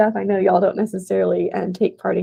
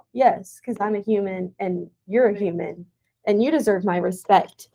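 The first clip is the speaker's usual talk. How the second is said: ordinary talk, nothing marked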